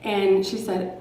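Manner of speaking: plain reading voice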